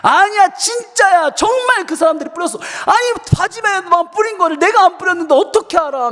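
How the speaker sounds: put-on high, loud kid protesting; acted-out child insisting he's telling the truth; insistent protest. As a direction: protest your innocence at a high pitch. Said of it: "내가 막 주장하기 시작한 거죠".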